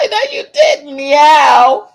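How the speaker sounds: high squeal of no you didn't; shocked squeal. Real, not acted. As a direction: shriek it out in delighted disbelief. Shocked squeal at a chat comment.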